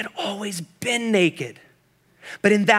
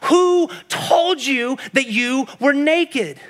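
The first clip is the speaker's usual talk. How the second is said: slow, higher, emphatic preaching question; impassioned demand; 6 semitones higher, much slower than usual. Acted. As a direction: drive every word home from the pulpit. Preacher's climax of God's question.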